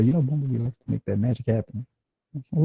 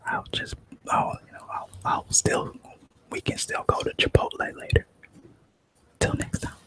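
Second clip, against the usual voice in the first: whisper; 4 dB quieter than usual. Real, not acted. Whispered outro.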